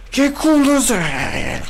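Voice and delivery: high, drawn-out exclamation; excited yell. Real, not acted. Gamer reacting to a lucky basket, excited yell. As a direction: let it ring out high and long.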